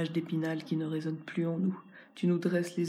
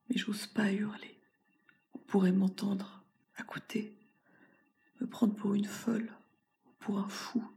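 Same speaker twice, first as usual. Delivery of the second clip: whisper; 3 semitones higher than usual. Acted. Fearful confession, afraid of being heard.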